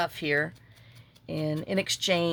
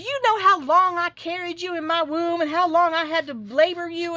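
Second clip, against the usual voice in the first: higher, louder mock-indignant mother; guilt-trip comeback; 11 semitones higher, 6 dB louder than usual. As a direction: lay on the guilt trip, loud and high.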